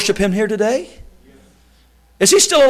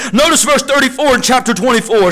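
ordinary talk, nothing marked

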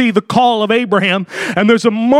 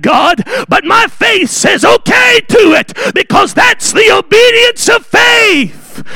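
full-force preaching; 11 semitones higher, 4 dB louder than usual. Acted. Preacher rousing the congregation about God's voice, shouting. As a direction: build to a shouting climax of God's promises.

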